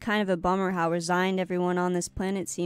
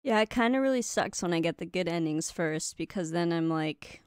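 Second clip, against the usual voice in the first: ordinary talk, nothing marked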